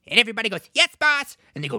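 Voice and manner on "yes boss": eager group reply. Retold or imitated. Group answers leader.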